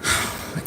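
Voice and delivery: soft wordless sigh of dismissal; imitated huff. The girls' reply before walking away, imitated soft huff. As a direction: let out a quiet breathy huff. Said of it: "And they were like".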